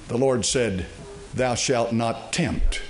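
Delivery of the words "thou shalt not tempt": slow, low scriptural pronouncement; solemn rebuke. Acted. Preacher quotes the Lord answering Satan, slow and solemn. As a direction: space out each word, sinking lower and softer to the end.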